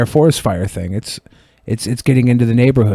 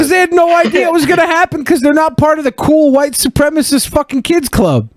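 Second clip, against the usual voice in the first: high-pitched, worked-up rant; heated rant; 13 semitones higher than usual. Real, not acted. Host ranting about kids left out of the photo, heated and high. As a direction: push the pitch way up and hold it there.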